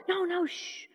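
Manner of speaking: soft, high hushing; embarrassed shushing. Acted. Son trying to quiet his father, hushed. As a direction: shush him quickly under your breath.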